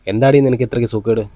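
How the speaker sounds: ordinary delivery, nothing marked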